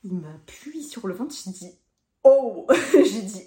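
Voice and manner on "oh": sudden loud "oh" of pain; pained cry. Retold or imitated. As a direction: cry out as if pressed on a sore belly. Retelling pain at the ultrasound.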